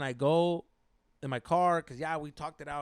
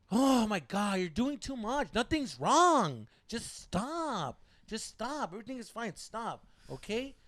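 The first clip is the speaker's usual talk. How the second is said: drawn-out, pitched-up "you're overreacting" brush-off; mock exasperated girlfriend; 6 semitones higher, slower than usual. Girlfriend telling boyfriend nothing's wrong, mock exasperated. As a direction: imitate her high, put-upon protest.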